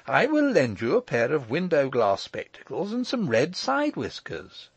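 eager offer climbing high at the end. A narrator's reading. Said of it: "cried Michael eagerly".

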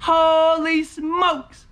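high, long-drawn exclamation; amazed whoop. Real, not acted. Scratcher marvels at the money bag, amazed whoop.

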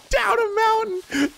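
laughing. Real, not acted. Laughing after the ride.

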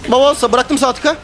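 excited outburst. Real, not acted. Reacting to a clutch moment.

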